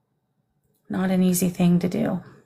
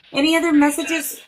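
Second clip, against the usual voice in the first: eager asking; 8 semitones higher than usual. Real, not acted. Medium asking son for messages.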